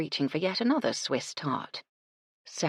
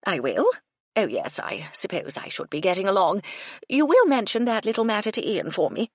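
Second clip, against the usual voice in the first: fluttery, flustered society lady; eager gossip hoping for a favour; flustered chatty woman; 5 semitones higher, 6 dB louder than usual. A narrator's reading. Flustered Phoebe voiced.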